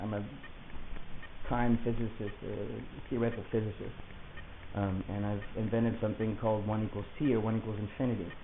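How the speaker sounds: normal talking voice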